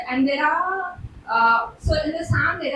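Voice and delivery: ordinary talk, nothing marked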